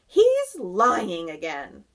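loud, drawn-out accusation; mild shriek. A narrator's reading. Earthworm accusing the centipede, mild indignant shriek. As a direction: accuse him with a long, punched "lying". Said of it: "shrieked the earthworm".

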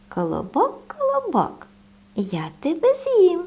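a fox voice that swoops high on the second «колобок»; lightly voiced cunning fox; sly fox. Fox threatens the bun. (лисичка хитро погрожує колобкові)

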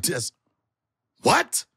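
retold disbelief, "what" jumps way up; fake worried shock. Friend hearing there are no tickets, faking shocked worry. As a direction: play the shocked friend, a touch hammed. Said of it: "Fake worried".

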